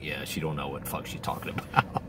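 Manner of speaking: low, under-the-breath scoff; muttered aside. Retold or imitated. Listeners mocking the wife's advice under their breath. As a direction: mutter it like a shared joke. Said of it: "You're like".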